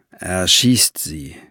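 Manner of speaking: slow, low execution order; drawn-out, weighty command; cold commander. A narrator's reading. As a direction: give the order slowly and low. Commander orders execution, then leaves.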